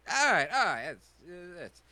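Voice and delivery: high, loud haggling merchant voice; pushy shopkeeper. Acted. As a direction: sell it hard, high and loud. Shopkeeper NPC voice.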